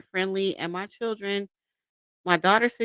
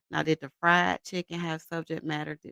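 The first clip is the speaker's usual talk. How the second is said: ordinary talk, nothing marked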